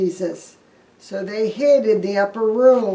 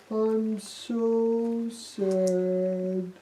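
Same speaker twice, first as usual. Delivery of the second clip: mopey Thomas; 9 dB quieter, much slower than usual. Acted. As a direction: drag out the sadness in a sulk. Thomas moping.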